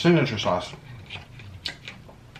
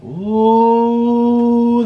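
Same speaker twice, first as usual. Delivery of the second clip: excited ooh; 8 semitones higher, 5 dB louder than usual. Real, not acted. Tasting sauce.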